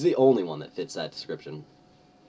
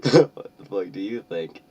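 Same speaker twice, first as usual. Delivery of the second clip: angry; 8 dB louder than usual. Acted. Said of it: "I asked angrily".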